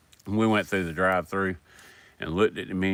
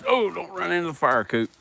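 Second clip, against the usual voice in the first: higher-pitched warning to a dog; urgent warning; 10 semitones higher than usual. Real, not acted. Owner warns dog near the fire, urgent high call. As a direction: warn the dog off in a raised pitch.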